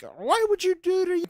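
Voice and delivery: high, exasperated mock demand; imitating angry wife. Wife demands answers after the prank, imitated high outrage. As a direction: put on her high scolding voice. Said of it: "she comes in and yells at me".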